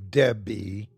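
"Debbie" with the second syllable drawn out and leaned on, deb-BEE; stress on second syllable. A narrator's reading. Tony drives his point home, "Debbie" stressed on second syllable. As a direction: lean hard on the end of "Debbie". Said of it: "inflection on the second syllable".

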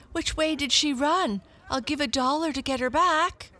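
high, hurried appeal; urgent cat owner. A narrator's reading. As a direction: press bystanders urgently in a high voice. Owner urgently seeks lost cat.

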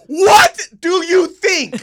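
high, loud, slow with a laugh; sarcastic retort. Retold or imitated. Son answering his dad about the edited photos, loud sarcastic retort. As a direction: fire it back in disbelief. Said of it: "I went".

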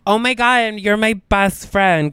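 high gushing mock excitement; hammed-up clingy gush; gushing Victoria. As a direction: squeal it like an instant best friend. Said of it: "she'd be like".

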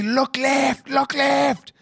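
high, strained retold yell; acted high-pitched cry for attention; desperate calling out. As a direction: call urgently across water.

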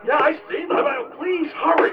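high, strained, begging; desperate plea. Acted. Trapped caller begging for help.

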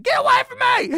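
a hammed-up shriek to shoo someone off; a woman on the street yelling at the man filming her; mock yell.

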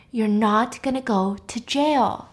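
slow, deliberate insistence; acted slow, emphatic promise; firm reassurance. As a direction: space out the words to get it through. Repeats firmly to panicking friend.

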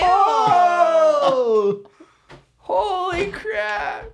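high, loud, slow shocked squeal. Real, not acted. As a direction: shriek it in disbelief. Reacting in shock.

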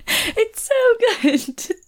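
high, drawn-out squeal through laughter; helpless high-pitched giggling; real laughing delight. Real, not acted. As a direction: laugh so much the words come out high and slow. Cracking up over the retold speech.

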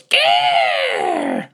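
very high shouted gasp; revived bird squawk. Acted. DM voicing the revived falcon, shrill shout. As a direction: burst back to life with a shriek. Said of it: "He goes".

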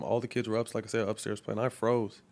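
ordinary delivery

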